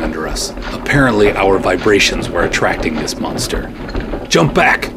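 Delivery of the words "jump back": high loud command. Acted. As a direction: snap the order out. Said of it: "I commanded".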